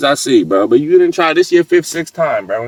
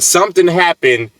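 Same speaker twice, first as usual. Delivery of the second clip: usual speaking voice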